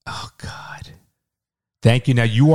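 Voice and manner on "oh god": breathless whispered aside. Real, not acted. Mom jokes about forty-two minutes.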